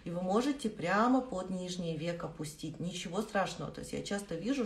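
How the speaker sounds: ordinary talk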